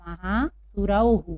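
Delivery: महासुरौहु drawn out slowly, ending in हु; महासुरौहु as ma-haa-su-rau-hu; slow model reading. Shown as a demonstration. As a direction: read महासुरौहु syllable by syllable. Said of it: "क्या पढ़ेंगे हम इसको?".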